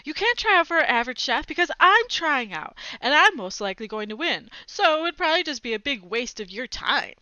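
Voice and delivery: loud, high blurt; a loud boastful blurt. Acted. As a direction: burst it all out at once. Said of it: "blurted out".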